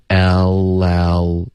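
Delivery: "L L" drawn out and joined as el-lel; slow linked demo. Shown as a demonstration. Teacher showing students linking, "L L" slowly joined as el-lel. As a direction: link "L L" slowly, second L starting on the first's ending. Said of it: "这个时候要连读".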